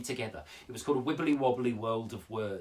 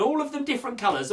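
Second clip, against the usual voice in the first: ordinary talk, nothing marked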